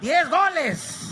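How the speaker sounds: slow, high, drawn-out "Diez goles" in Spanish; Spanish-accented dad. Retold or imitated. Dad hammering home his demand in Spanish, slow.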